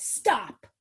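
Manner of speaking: a loud, firm "Stop."; child says stop. Shown as a demonstration. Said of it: "And the child then says".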